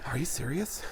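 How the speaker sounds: breathless whisper, few words at a time; winded, distressed and breathy; out of breath Mark Wahlberg. Shown as a demonstration. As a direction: gasp the words out, stressed. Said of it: "This is the whole Mark Wahlberg impression".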